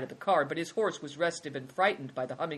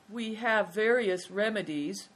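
high, slow, sorrowful voice; mildly mournful high voice; sad; 6 semitones higher than usual. A narrator's reading. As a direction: admit it sadly, drawn out. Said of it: "said the man sadly".

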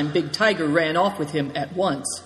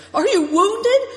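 high, loud call from far away; breathless shout; 6 dB louder than usual. A narrator's reading. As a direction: shout it out, winded from running. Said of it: "he shouted breathlessly from a long way off".

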